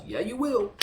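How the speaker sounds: loud, high mock retort; forceful retort. Man overruling her before decking her, loud retort. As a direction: imitate someone loudly overruling a protest.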